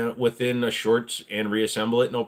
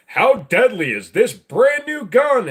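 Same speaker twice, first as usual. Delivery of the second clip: higher, louder, slow hyped hype-man voice; mock gun youtuber; 10 semitones higher, 8 dB louder, slower than usual. Gun youtubers hyping a new gun, loud slow mock hype. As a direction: ham up a clickbait gun reviewer. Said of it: "The rest of them is like".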